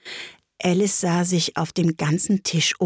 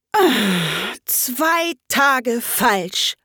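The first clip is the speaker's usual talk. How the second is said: long, drawn-out sigh of dismay; heavy sigh stretched through the words; 5 semitones higher, much slower than usual. A narrator's reading. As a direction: groan it out very slowly. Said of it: "seufzte der Hutmacher".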